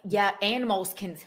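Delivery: normal talking voice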